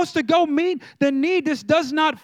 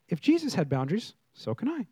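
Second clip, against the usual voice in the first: soft first try; 5 semitones lower, 8 dB quieter than usual. Shown as a demonstration. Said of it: "Okay, if Jesus had boundaries".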